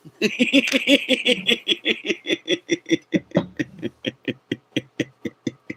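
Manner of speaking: a long, very high, fading laugh. Real, not acted. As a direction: giggle on and on. Laughing at own joke.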